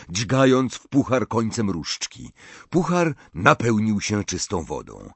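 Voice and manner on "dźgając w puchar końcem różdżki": plain reading voice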